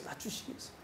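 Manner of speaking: whispered echo of 낮추시기 위해서, breath only. Shown as a demonstration. Pastor echoing his point to the congregation, whispered. Repeats phrase, then whispers it.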